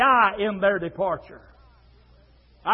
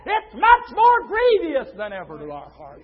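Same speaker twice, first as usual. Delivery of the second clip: raised voice with shouted peaks; impassioned preaching; 7 semitones higher, 7 dB louder than usual. Real, not acted. Preacher on grief at loved ones departing, impassioned preaching. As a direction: press it home loudly to the congregation.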